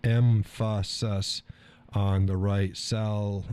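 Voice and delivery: "emphasis" drawn out with the wrong syllable stressed; misplaced stress. Retold or imitated. Quoting a listener's joke on stress, "emphasis" said em-FAS-sis. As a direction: stretch "emphasis" slowly as em-FAS-sis, then "sal-" as sal. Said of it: "Kinda like putting the correct".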